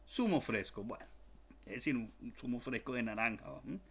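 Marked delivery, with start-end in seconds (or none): none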